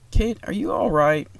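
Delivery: put-on high-pitched older mother asking; older woman. A narrator's reading. Mother checking on her daughter, older female voice. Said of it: "older female voice".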